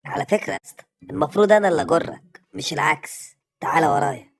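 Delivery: loud commanding retort; bossy loud. Acted. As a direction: talk down to him loudly. Bossy loud dog talking.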